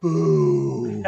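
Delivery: a loud jokey boo at the rival team; mock boo. Answering "Boston Red Sox" in word association with a playful loud boo. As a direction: boo them like a Yankees fan. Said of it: "Boston Red Sox".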